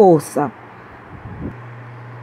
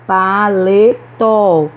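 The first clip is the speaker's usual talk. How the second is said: "Paletó" very slow, syllable by syllable, strong tó; stress on last syllable; much slower than usual. Shown as a demonstration. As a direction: stretch out "Paletó", landing firmly on tó. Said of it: "Também é oxítona". ("Paletó" bem devagar, silabado, com força em tó)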